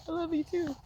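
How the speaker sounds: high, choked-up affection; tearful. Retold or imitated. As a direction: say it back through retold tears. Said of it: "I'm crying".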